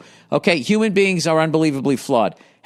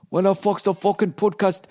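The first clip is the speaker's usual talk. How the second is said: mock Scottish accent; 3 semitones higher than usual. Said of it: "I can't do a Scottish accent".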